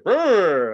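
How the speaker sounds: loud rolled scare noise; imitated ghost growl. Haunt actor to diners in the fog, mock scare roar.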